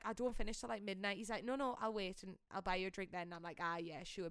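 ordinary talk, nothing marked

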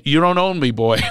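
loud, high, tough Philly twang; Philly accent. Retold or imitated. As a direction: bark it like a Philly tough guy. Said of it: "I said it like Philly".

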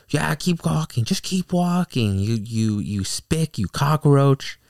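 high, loud mocking sneer, slowing at the end; mock the bigoted father jeering. The driver's dad jeering as he walks away, mocking and loud.

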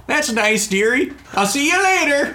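very high-pitched, sweetly oblivious character; dotty dearie voice. Acted. Grenwyn patting the Lord Magician's hand, dotty and high-pitched. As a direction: play an oblivious dear saying goodbye.

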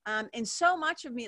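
normal talking voice

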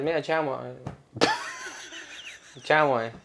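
light Venezuelan lilt on mira chamo; Venezuelan accent. Retold or imitated. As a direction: mimic the Venezuelan barber, casual. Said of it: "hace el acento".

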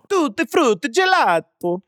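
high, singsong mock Italian; Italian accent. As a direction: announce it like a stage Italian. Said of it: "done doing that".

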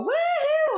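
very high, loud joyful whoop; high-pitched woohoo. Shown as a demonstration. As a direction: scream it out going downhill. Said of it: "high pitched scream, let it out".

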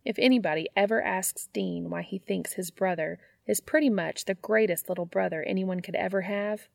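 usual speaking voice

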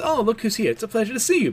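a pleasant surprised greeting in a high, gentle voice; sweet Yor voice. Retold or imitated. Yor greets Becky with a high sweet welcome. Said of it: "She says".

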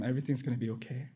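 normal talking voice

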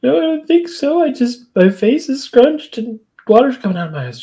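high-pitched imitation of crying; put-on weepy squeak; mock tearful denial. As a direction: act out denying tears in a high voice. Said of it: "Topanga, are you crying?".

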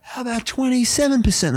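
high, put-on voice making a lowball offer; mock negotiation offer.

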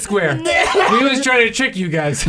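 hammed-up surrender while laughing; laughing mock confession. Mocking the caught kids.

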